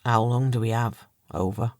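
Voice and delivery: forcing words out, throat tight; strained, choked-up question. A narrator's reading. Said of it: "pushing the words out of his throat".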